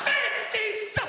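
sudden high yelled fragment; mock shout. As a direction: blurt it out high and loud. Mock outburst cut off.

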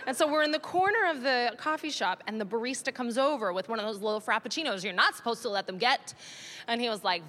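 ordinary talk

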